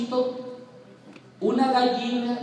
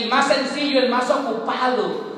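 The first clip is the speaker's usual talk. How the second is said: louder, higher preaching climax; predicación con fuerza; 5 semitones higher, 5 dB louder than usual. Real, not acted. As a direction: drive it home to the congregation. (predicador exhortando a los hermanos con fuerza)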